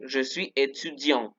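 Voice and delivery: "étudiant" repeated slowly with no T; slow model of "étudiant" with the T left silent; silent final T. Shown as a demonstration. Teacher repeats the masculine form for students, slow French. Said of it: "no se pronuncia la T".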